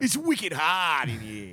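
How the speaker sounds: Boston accent. Shown as a demonstration. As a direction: lean into the Boston accent, stretched out. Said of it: "do it".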